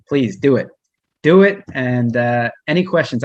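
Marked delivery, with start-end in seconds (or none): 1.2-1.6 much higher and louder, punched; emphatic urging. Real, not acted. Speaker urging the audience to take up the work, loud and emphatic. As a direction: fire it off as a rallying push.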